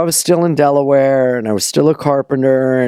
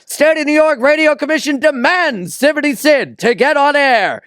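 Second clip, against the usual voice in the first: high, pompous proclamation; pompous official; 12 semitones higher than usual. Retold or imitated. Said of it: "Howard Stern's dad's like".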